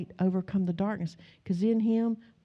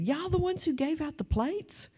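high-pitched retold surprise; incredulous question, pitched way up; astonished question; 9 semitones higher than usual. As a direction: imitate a kid amazed at who this is. Retold kid's astonished recognition.

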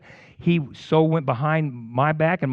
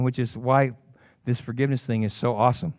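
ordinary talk, nothing marked

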